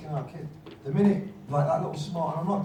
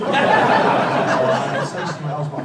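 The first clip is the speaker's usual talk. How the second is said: laughing; 10 dB louder, much slower than usual. Real, not acted. Laughing while holding back a jibe.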